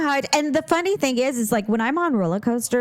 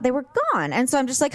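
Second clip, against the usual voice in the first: ordinary delivery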